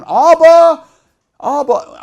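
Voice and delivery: high, very loud call; crying out. Acted. Child crying out Abba to the Father, loudly.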